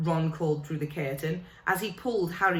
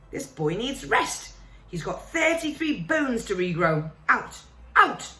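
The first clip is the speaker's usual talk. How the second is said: building from firm to a full shout; shouting nurse; 4 semitones higher, 3 dB louder than usual. Acted. Nurse Madame Pomfrey throwing the team out, shouting louder each word. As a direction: nurse driving visitors out, rising to a furious shout.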